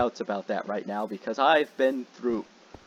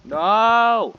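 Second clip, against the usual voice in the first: panicked shout; 9 semitones higher, 10 dB louder than usual. Real, not acted. Being attacked in game.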